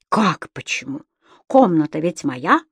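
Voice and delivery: usual speaking voice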